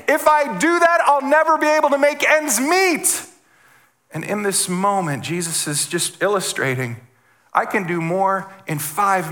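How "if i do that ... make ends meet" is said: high, louder mock panic of a worried believer; imitating a worrier. Pastor voicing a worried churchgoer, high mock panic. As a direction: voice the anxious thought, pitched up. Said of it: "because you think".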